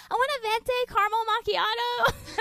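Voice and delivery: put-on high, laughing, girly order; imitating a girl. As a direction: mimic a girl ordering coffee. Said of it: "this girl goes".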